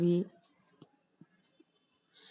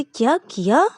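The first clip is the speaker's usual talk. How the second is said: indignant retort; 7 semitones higher, 10 dB louder, slower than usual. Acted. Angry wife retorts.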